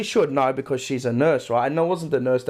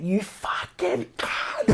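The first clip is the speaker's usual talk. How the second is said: retold scream; 3 semitones higher, 6 dB quieter, much slower than usual. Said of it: "I screamed".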